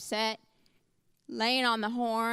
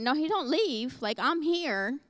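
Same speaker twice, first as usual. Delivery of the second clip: mildly raised, offended protest; indignant retort; 3 semitones higher, slower than usual. Retold or imitated. To the receptionist, mock-indignant about her appointment. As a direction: protest like you're wronged. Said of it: "I'm so indignant and I'm so offended".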